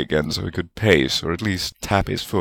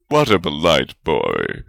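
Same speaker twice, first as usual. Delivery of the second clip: proud; 2 semitones lower than usual. A narrator's reading. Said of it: "Godric said proudly".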